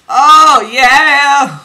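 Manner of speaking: loud, high, drawn-out cheer; exultant outburst. Real, not acted. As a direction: belt out a delighted cheer.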